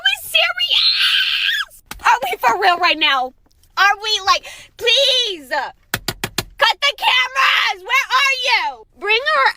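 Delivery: crying, shouting, words dragged out; distraught shouting. Real, not acted. Upset woman pleading to cut the cameras, crying and shouting. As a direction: wail it out, furious and heartbroken.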